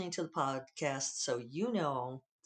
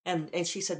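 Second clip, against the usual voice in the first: ordinary talk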